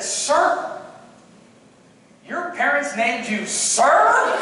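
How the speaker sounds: high, drawn-out questioning; incredulous joke. Retold or imitated. Cadet ribbing drill instructor about his name, incredulous. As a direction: ask in slow mock disbelief.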